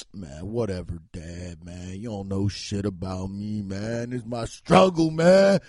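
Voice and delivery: very low slurred slacker voice, swelling at the end; put-on addict thug, building loud; wannabe-thug son. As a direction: brush off dad in a mock-rapper mumble. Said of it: "talk like they're about to drop a fucking mixtape".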